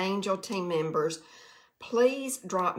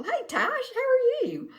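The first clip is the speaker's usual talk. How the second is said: much higher sing-song hello; high greeting; 4 dB louder than usual. Real, not acted. Greeting a viewer by name in chat, high sing-song.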